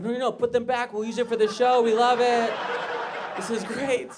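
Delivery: high, loud, mounting enthusiasm; hammed-up thrilled TV man; excited producer. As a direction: build to a delighted yell. Producer thrilled about dead cats.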